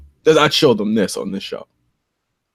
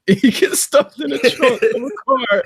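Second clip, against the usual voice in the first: laughing; 13 semitones higher, slower than usual. Real, not acted. Laughing while describing the trunk scene.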